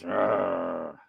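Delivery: Rrr as a long trilled R; rolled R. Shown as a demonstration. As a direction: roll the R in Rrr. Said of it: "Roll them R's".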